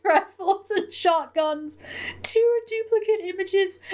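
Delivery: very high, laughing through the words; squeaky with laughter, barely holding it together; cracking up. Real, not acted. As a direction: read on through helpless giggles. Cracking up while reading email.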